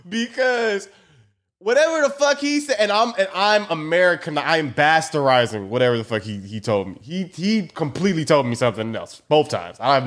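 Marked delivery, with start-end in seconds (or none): none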